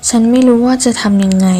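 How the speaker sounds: usual speaking voice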